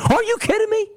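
high, disbelieving yelp; can't believe what he's hearing; incredulous outburst. Real, not acted. Host ranting about bowl games, incredulous high yelp.

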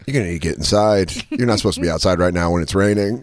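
low, fast, weary crew voice; deckhand voice. Retold or imitated. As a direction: hurry the passenger inside, low and fast. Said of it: "the guy working on the boat goes".

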